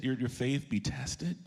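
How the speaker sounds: ordinary delivery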